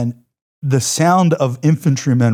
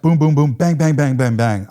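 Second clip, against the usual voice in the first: loud, higher mock booms and bangs; imitated explosions; 5 semitones higher, 6 dB louder than usual. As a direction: punch out each boom and bang like gunfire going off.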